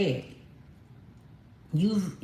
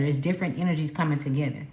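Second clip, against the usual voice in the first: ordinary delivery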